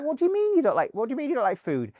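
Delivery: very high, loud disbelief; retold incredulity. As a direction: question him in squeaky mock disbelief. Retold disbelief at actor's diet.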